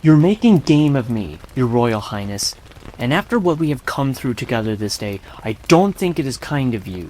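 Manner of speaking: put-on low, wounded boy's reproach; very much hurt. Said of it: "feeling very much hurt".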